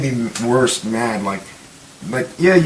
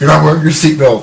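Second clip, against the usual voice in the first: loud, higher-pitched pretend nagging; mock scolding; 5 semitones higher, 8 dB louder than usual. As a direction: call out a hammed-up safety warning. Mock scolding of film's motorcycle rider.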